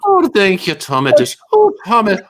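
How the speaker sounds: high, breathy swoon over Tommy. Pretend. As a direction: gush like a smitten fan.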